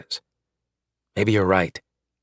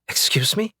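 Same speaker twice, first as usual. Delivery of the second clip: high, sharp indignant question; wife bristling at husband, high indignant; indignant excuse me; 11 semitones higher than usual. Acted.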